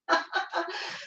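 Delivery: light, high-pitched chuckle; soft laugh, pitched high; real laugh. Real, not acted. Amused aside about her tea.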